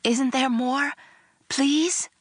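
high, pleading put-on eagerness; faked ecstasy. A narrator's reading. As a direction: beg sweetly, rising on the last word. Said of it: "I faked ecstasy".

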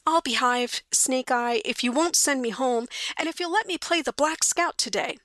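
put-on high, aggrieved little-boy voice; put-upon boy pleading his case; sulky kid. Kid makes excuses and bargains.

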